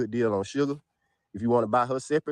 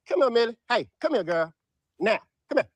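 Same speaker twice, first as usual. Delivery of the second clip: high, coaxing call to the dog; 9 semitones higher, 3 dB louder than usual. Real, not acted. Owner calling his dog Millie over. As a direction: coax her over in a high voice.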